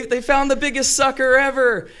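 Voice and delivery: high, loud imitated jeering; mocking jeer. Friends teasing him about the Kickstarter, mocking jeer. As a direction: crow it loudly, mimicking doubters. Retelling everybody's mocking reaction.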